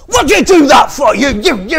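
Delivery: loud, high, spluttering yell; shouting at the man who soaked him; furious drenched man yelling; outraged shout. Retold or imitated. Drenched man yells at Spurgeon.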